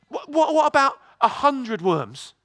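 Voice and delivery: ordinary delivery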